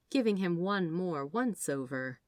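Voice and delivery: plain reading voice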